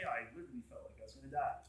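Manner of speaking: quick, soft and low, stunned; fast quiet awed confession; hushed awe. Retold or imitated. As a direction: murmur it as if still shaken. John's words, second take, hushed.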